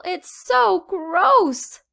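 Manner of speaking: put-on high, slow child voice, grossed out; disgusted little girl. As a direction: drag it out, totally grossed out.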